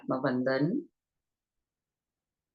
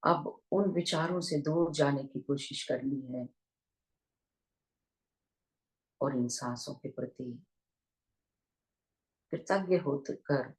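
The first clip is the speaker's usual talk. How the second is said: unhurried meditation guidance with long pauses; guided meditation; 3 dB quieter than usual. Real, not acted. Teacher leading online listeners into breath awareness, guided meditation voice. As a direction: guide listeners gently into their breath, leaving long silences.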